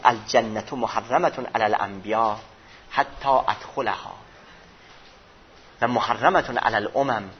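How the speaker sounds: slow, solemn Arabic recitation; measured devotional reading of Arabic; hadith recitation. Acted. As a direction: intone the hadith slowly and reverently.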